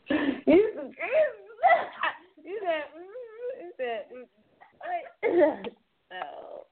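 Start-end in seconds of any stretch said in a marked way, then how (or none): 0.1-5.8 laughing. Real, not acted. Laughing at the caller.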